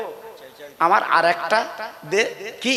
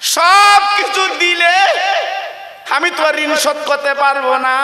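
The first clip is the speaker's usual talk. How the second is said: a son crying that he can never repay his mother; impassioned wail; 8 semitones higher, 4 dB louder than usual. Acted. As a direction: stretch out the cry to mother. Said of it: "ছেলে বলে".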